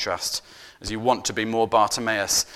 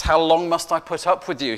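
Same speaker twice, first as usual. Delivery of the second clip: mildly exasperated, a bit raised and louder; 5 semitones higher, 6 dB louder than usual. Retold or imitated. Preacher voicing Jesus fed up with his disciples, mild exasperation. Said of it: "Jesus is exasperated with his disciples".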